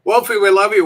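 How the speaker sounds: loud, cheery shout-out to a fan; big warm on-air shout-out; calling out. Real, not acted. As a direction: call out over the room to Wolfie.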